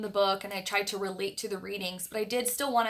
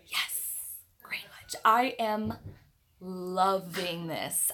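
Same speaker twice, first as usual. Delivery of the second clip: hushed whisper reading a viewer's town; 4 semitones lower, much slower than usual. Real, not acted. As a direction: whisper it like a shared secret. Whispering reply to chat.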